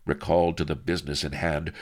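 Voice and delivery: ordinary delivery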